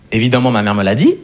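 ordinary delivery

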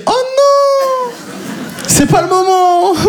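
high, drawn-out inner wail; high, slow comic dismay; mock panic. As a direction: wail it in hammed-up despair. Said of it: "moi, je me dis".

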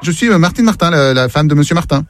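ordinary talk, nothing marked

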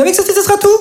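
high sing-song shop question; mock baker. Comedian playing the baker asking her stock question. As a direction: squeak it like the chirpy baker.

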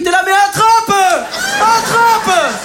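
crié très aigu, qui pousse son fils; cri de papa stressé. Retold or imitated. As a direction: hurler attrape au gosse sur le manège. (papa au manège crie à son fils, très aigu et fort)

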